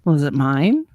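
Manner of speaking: low, level deadpan question; dry imitation of a husband. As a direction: ask it with no reaction at all. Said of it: "just deadpans".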